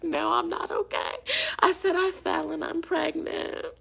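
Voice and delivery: high, plaintive re-enactment; retold distressed plea. Retelling her answer to the helper after falling, high mock distress. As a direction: pitch it up like a hammed-up cry for help.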